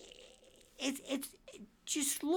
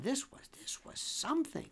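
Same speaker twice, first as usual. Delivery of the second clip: awed whisper; 7 semitones higher, 7 dB quieter than usual. Real, not acted. Awed aside.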